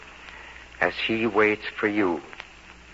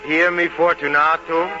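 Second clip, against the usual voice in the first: raised, higher call; louder, higher cry; cried out; 7 semitones higher, 6 dB louder than usual. Retold or imitated. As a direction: call out into the wall. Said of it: "I cried out to him".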